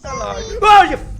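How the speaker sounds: sudden frightened high yelp; jump-scared shriek; startled scream. Real, not acted. Jump scare from creepy kid video.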